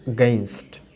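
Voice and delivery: "against" almost like "gainst", the a barely heard; weak opening vowel. Shown as a demonstration. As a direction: say "against" low, swallowing the first a. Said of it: "এটা উইক হয়ে গেছে". ("against"-এর শুরুর আ প্রায় না শোনানো)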